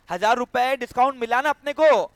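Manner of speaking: usual speaking voice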